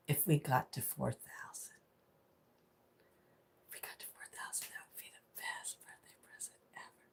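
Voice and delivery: hushed secret wish; unvoiced wishful whisper. Real, not acted. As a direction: breathe it like a secret.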